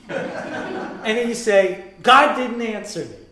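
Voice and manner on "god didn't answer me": higher, louder, laughing through it; mock the caller who hung up, laughing; laughing mock complaint. Praying person complaining God never answered, laughing and loud.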